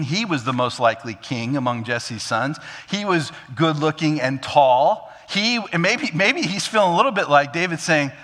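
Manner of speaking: usual speaking voice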